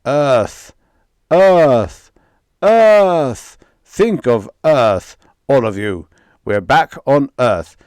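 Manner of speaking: loud rising chant of Earth; rally the crew with a booming call; bellowing commands. Acted. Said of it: "he bellowed".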